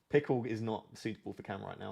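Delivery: ordinary talk, nothing marked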